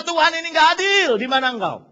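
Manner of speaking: loud, high accusing cry; crying out at God. Retold or imitated. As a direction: shout the unfairness at heaven. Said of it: "kita merasa".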